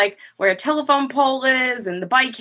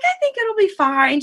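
high mock-optimistic mom voice; imitating dismissive mother; 13 semitones higher than usual. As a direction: play it down as Mom, pitched up. Voices mother downplaying injury.

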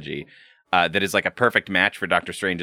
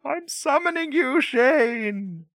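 mystical summoning voice; much slower than usual. Pretend. Mock summoning.

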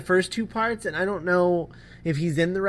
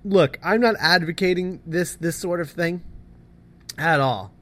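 normal talking voice

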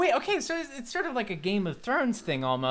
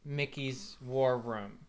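dramatic narration; 4 semitones lower, 3 dB quieter than usual. Acted. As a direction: tell it like a grim trailer.

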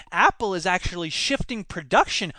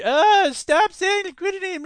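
mock nerdy complaint; 11 semitones higher, 4 dB louder than usual. Mocking emailing economists.

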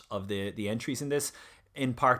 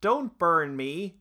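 high, slow desperate begging; pleading; 8 semitones higher, 5 dB louder, much slower than usual. Retold or imitated. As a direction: beg for your life, voice rising. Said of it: "he pleaded".

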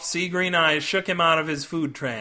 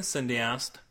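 ordinary delivery, nothing marked

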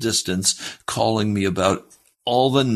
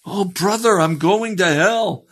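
despairing wail; 12 semitones higher, 3 dB louder than usual. Retold or imitated. Sinner pleading with the preacher, acted high despairing cry. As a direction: imitate a desperate sinner crying out high.

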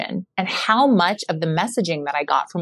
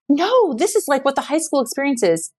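insistent mom; 8 semitones higher than usual. Retold or imitated.

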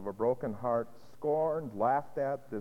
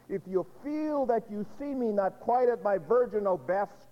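loud, high, scornful rage of a kitchen maid; Aldonza's bitter outcry; 6 semitones higher, 5 dB louder than usual. Acted. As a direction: hurl it at him like an accusation. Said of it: "And she responds and says".

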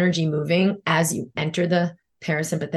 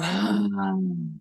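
long releasing sigh; 2 semitones higher, 5 dB quieter than usual. Acted. Release breath in guided relaxation.

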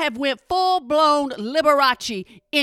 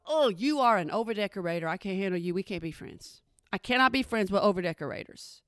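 muttered aside; 6 semitones lower, 8 dB quieter, faster than usual. Retold or imitated. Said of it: "That makes me wanna go".

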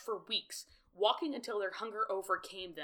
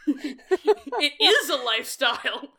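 laughing mock lecture; 5 semitones higher, 8 dB louder than usual. Mock PSA scolding, laughing.

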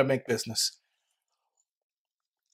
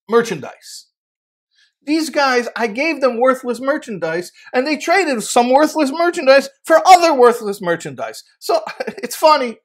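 amused explanation; 8 semitones higher, 6 dB louder than usual. Acted. Father-in-law explains the joke.

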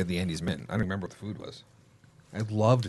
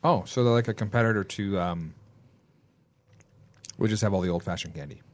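mouth full; 4 semitones lower than usual. Real, not acted. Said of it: "Sorry, I'm eating my mint already".

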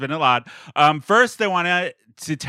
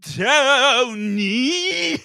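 high call with a laugh; from another room; 10 semitones higher than usual. Retold or imitated. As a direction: call the name across the house. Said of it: "I hear him go".

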